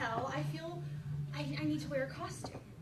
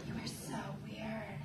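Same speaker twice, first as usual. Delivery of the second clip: put-on flat kid voice; 7 semitones lower, 7 dB quieter, slower than usual. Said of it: "kid's verdict".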